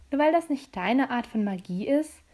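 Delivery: ordinary delivery, nothing marked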